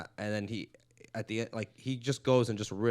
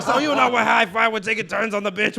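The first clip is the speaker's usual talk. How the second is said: building from talk into a loud, high, laughing rant; Joey Diaz riffing; 9 semitones higher, 10 dB louder than usual. Retold or imitated. Retelling Joey Diaz roasting an open mic crowd, loud laughing rant. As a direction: ham up the big comic working the room, louder each line. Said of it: "That's a good impression".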